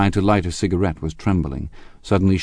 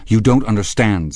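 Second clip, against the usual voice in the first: raised, urgent insistence; touch of panic; 4 semitones higher, 6 dB louder than usual. A narrator's reading. Gatsby corrects the husband, with a touch of panic. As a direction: insist with alarm creeping in. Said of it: "said Gatsby with a touch of panic".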